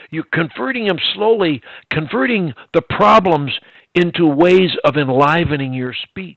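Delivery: emphatic. Real, not acted. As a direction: drive the point home loudly. Excited emphatic teaching point.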